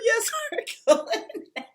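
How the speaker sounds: high, drawn-out laughing yes; laughing re-enactment. Retold or imitated. Daughter confirming the surprise to mom, laughing high. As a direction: replay the happy answer through laughter.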